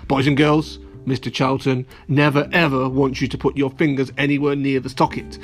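ordinary delivery

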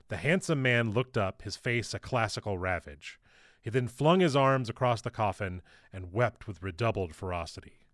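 plain reading voice

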